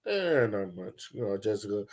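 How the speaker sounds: lazy, dismissive reply to the boss; nonchalant attitude. Retold or imitated. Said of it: "this is my attitude".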